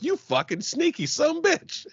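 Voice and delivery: playfully outraged at being fooled; shocked squeal. Retold or imitated. Fiancée squealing at the surprise proposal, playfully outraged. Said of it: "she was like".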